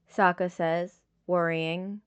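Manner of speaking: ordinary delivery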